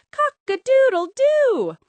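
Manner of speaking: long high loud crow; loud rooster crow. Acted. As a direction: crow it out proudly. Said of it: "Rooster crowed".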